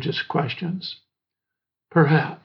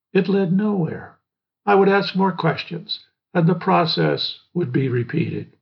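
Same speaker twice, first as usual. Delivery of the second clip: usual speaking voice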